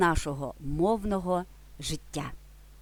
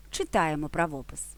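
normal talking voice

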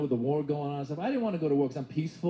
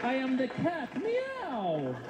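playful cat meow; 7 semitones higher, much slower than usual. Retold or imitated. Cat meow joke again.

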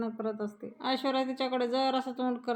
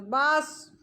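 sharp, loud angry cutoff, higher; angry enough; 4 semitones higher, 4 dB louder, much slower than usual. A narrator's reading. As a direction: snap it shut in sudden anger. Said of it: "आई रागात म्हणतात". (संतापून मोठ्याने थांबवणारे बास)

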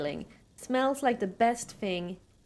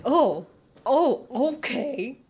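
disappointed neighbor; 3 semitones higher, 6 dB louder, much slower than usual. Pretend. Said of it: "the neighbor would be like".

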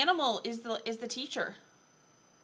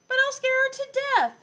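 high, quick, louder objection; alarmed protest; 13 semitones higher, 10 dB louder than usual. Acted. Pupil objecting to lion teacher, high mock alarm.

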